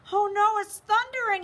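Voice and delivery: high, loud alarm; bunny scared of thunder; frightened cry. A narrator's reading. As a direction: gasp out in fright at the noise. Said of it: "he thought".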